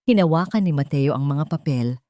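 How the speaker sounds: usual speaking voice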